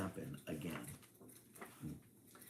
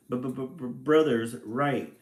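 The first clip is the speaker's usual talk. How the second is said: mild sobbing; 3 semitones higher, 7 dB louder, much slower than usual. A narrator's reading. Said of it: "she sobbed".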